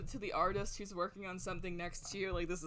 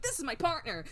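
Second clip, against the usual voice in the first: lightly voiced sunshine-cheerful partner; a hint of perky pride, pitched higher; Athena Sykes; 5 semitones higher, 4 dB louder than usual. Sunny partner introducing Simon, lightly acted cheer. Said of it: "Sunshine Incarnate".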